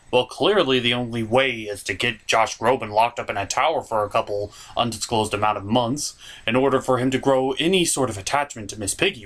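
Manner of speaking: ordinary delivery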